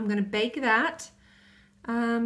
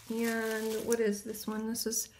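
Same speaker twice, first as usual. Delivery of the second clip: quiet; 7 dB quieter than usual. Real, not acted. Reading small print quietly.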